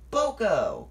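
loud surprised call; crying out. A narrator's reading. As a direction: call his name in surprise. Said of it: "cried Thomas".

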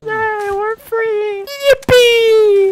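very high squealing cheer. Real, not acted. Player squealing after escaping, cheering. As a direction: squeal with joy at being free.

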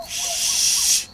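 a high, sharp whispered shush; a quick, urgent shush; hushing shh. Real, not acted. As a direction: hush everyone at once. Hushing in the night.

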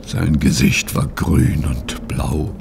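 whispered, slow and low; hushed horror. Acted. Etienne tells the detective how his friend was found, whispering. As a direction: breathe it out in shock.

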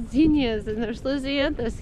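ordinary delivery

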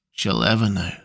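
dropping low and soft on the repeat. Acted. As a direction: let it sink into a hushed, grieving murmur. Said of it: "I said in a low voice".

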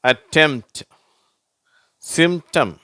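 "அட்டெம்ப்ட்" as attemt, then "சிம்டம்" as simtam; m straight into t in "அட்டெம்ப்ட்", "சிம்டம்"; silent P. Shown as a demonstration. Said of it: "அந்த பீன்ற உச்சரிப்பு வரதில்லை".

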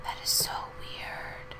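hushed whisper aside. Real, not acted. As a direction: breathe it as a secret.

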